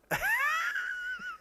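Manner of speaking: high, squealing fit of laughter. Real, not acted. Podcaster mortified by his impression, high fit of laughter. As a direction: lose it laughing.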